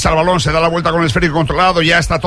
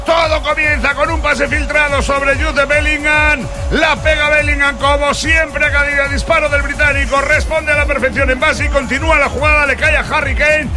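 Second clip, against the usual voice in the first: high-energy goal recap; 8 semitones higher than usual. Real, not acted. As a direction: keep shouting the replay with joy. Post-goal replay commentary.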